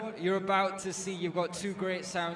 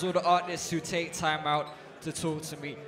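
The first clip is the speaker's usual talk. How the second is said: ordinary talk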